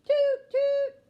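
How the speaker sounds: very high, loud trumpet blast; trumpet toot. A narrator's reading. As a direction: blare it like a trumpet. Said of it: "Bean plays his trumpet".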